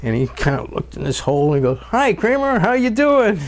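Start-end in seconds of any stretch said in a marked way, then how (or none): 1.8-3.4 much higher, cheery mock greeting; imitating the boss. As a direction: call down into the hole in a bright imitated voice. Retelling the boss greeting him.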